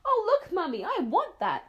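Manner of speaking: put-on high, eager child begging; bright-eyed little girl pleading; excited child. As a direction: squeal it like a kid spotting a treat.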